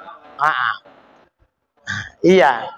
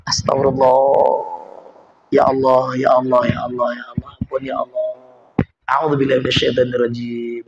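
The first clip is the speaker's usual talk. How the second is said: low pleading prayer; 7 semitones lower than usual. Retold or imitated. Preacher voicing an angry man's calming prayer, low and hushed. Praying to calm anger.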